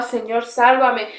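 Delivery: ordinary talk, nothing marked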